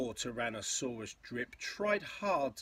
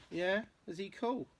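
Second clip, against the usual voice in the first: plain reading voice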